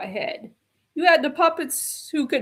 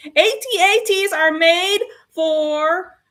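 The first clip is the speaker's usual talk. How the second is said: usual speaking voice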